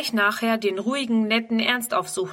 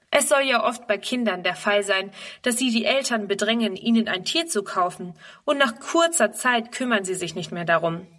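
ordinary delivery

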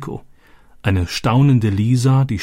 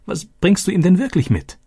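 much higher, pressing question; eager insisting; 10 semitones higher than usual. Acted. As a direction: push him for the real answer. Daughter insists after joke.

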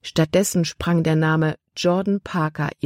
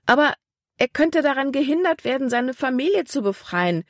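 high-pitched, agitated argument; worked-up protest, high throughout; heated anger; 9 semitones higher than usual. A narrator's reading. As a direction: press on urgently, voice pitched right up. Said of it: "wurde Libbys Stimme laut vor Zorn".

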